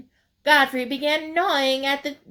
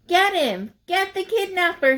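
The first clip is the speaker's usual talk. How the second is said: high, excited shout to catch him; rally the friends to grab him; shouted order; 8 semitones higher than usual. A narrator's reading. Rabbit spots the raccoon and yells to catch him. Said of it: "he shouted".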